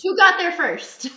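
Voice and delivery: slow, drawn-out teasing brag; mock boast. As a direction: tease it like a smug winner. Mocking the disciple's boast.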